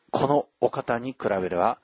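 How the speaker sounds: usual speaking voice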